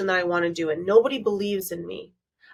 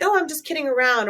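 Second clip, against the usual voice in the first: mock breezy brush-off, pitched higher; sarcastic me; 6 semitones higher, faster than usual. Coach imitating her old sarcastic self, light mock breezy. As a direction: toss it off like a joke you half mean. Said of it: "I called it the sarcastic me".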